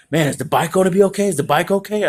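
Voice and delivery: high, quick fretful voice; worried grandfather. Retold or imitated. Grandfather worried about the bike, quick high fretting. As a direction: fuss over the bike, not the kid.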